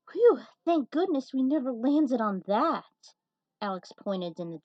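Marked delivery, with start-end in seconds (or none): 0.1-3.1 loud, higher burst of relief; relieved exclamation. Acted. Survivor relieved after the fall, loud and high. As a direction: blurt it out, glad to be alive.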